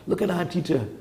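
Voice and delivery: ordinary talk, nothing marked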